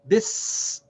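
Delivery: BIS ending on a long hissed s; no vowel after s. Shown as a demonstration. Teacher corrects bisa, Bis ending in a vowelless s. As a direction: stop Bis on the bare "s" sound. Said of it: "seen does not have a vowel".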